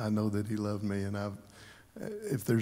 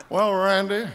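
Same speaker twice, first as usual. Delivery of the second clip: higher, louder fond mimic of a friend; playful higher impression of an old friend; imitating Les; 7 semitones higher, 7 dB louder than usual. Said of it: "we talked like that all day long".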